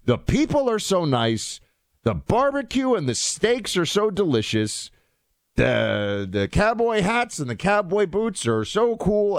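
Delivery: gushing tourist. Retold or imitated. Host imitating World Cup tourists raving about Texas, mock gushing. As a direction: rave like a delighted visitor, without the accent. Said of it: "I won't do their accents".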